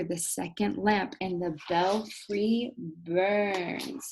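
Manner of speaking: very slow, drawn-out line; slow dramatic reading. Shown as a demonstration. Teacher reads the climactic line very slowly, dramatic. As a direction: linger over each word for drama. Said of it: "it even says right here".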